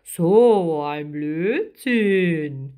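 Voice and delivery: very slow, loud grumpy giant; drawn-out dismissive creature voice; huffy octopus, stretching every word; annoyed. A narrator's reading. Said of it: "Der Krake verknotete ärgerlich".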